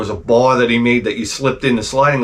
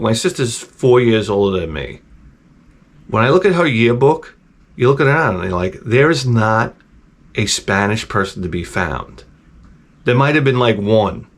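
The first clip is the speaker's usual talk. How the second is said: ordinary talk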